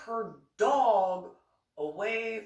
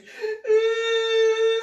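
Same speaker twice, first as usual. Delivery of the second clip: high crying laugh; laughing fit; 9 dB louder than usual. Real, not acted. Teller laughing hard while telling the fight, high and shrill. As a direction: break into helpless laughter.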